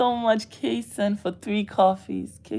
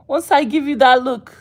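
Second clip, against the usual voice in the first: louder and louder, then faster; repeating it, getting faster and louder; building insistence; 5 semitones higher, 10 dB louder, faster than usual. Acted. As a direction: build it up, each time pushier. Acting out giving the look.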